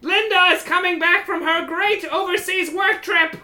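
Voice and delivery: high, loud declaiming kobold; kobold boss. Acted. Kobold announces Linda's return, high loud proclamation. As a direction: declaim it in a squeaky little voice.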